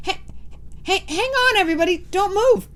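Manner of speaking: high, loud witch voice; urgent sniffing witch; excited witch. Acted. Mildred sniffing.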